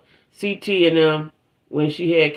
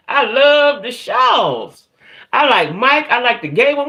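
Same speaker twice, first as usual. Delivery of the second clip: gushing; 7 semitones higher, 3 dB louder, slower than usual. Real, not acted. Gushing about favorite show.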